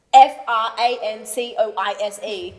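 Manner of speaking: letters spelled slowly and loudly; slow spelling out. Retold or imitated. At the spelling bee, spelling the name slowly and loudly. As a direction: spell each letter deliberately.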